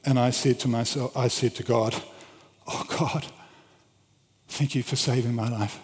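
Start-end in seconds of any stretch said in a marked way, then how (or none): 2.7-5.8 soft, half-whispered thanks to God; retold quiet prayer after a scare; hushed prayer. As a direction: murmur it privately in relief. Retold private prayer after near fall.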